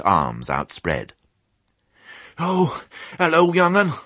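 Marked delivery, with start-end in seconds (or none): 2.4-3.9 put-on high, puffed rustic old ewe; puffing old sheep. A narrator's reading. Old sheep greets piglet on the run, puffing. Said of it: "puffed the old sheep".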